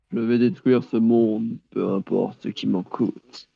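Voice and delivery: slow, low evil boss voice; menacing villain, drawn out. Pretend. Villain line after "je suis Exceller.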